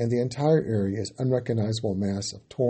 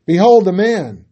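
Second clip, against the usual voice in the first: loud, higher proclamation; Pilate proclaiming; 7 semitones higher, 11 dB louder than usual. A narrator's reading. Pilate presenting Jesus to the crowd, loud proclamation. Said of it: "And Pilate said to them".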